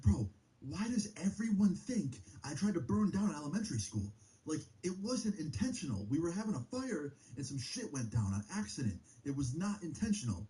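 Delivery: retold indignant protest, pitched high; indignant self-defence. Retelling his indignant self-defence to Ryan.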